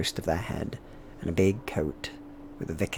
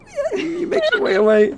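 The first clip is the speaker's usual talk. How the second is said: very high wailing voice; ghostly wail; 9 dB louder than usual. Acted. GM voicing eerie cry.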